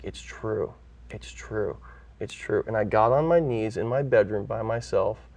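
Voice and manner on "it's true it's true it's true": softly repeated, a hint of wonder; quietly realising it's true, half to himself; hushed awe. Awed inner realisation while reading.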